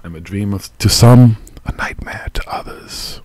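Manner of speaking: whispered spooky line; eerie whisper. Acted. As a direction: hush it like a ghost.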